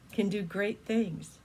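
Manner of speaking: ordinary delivery, nothing marked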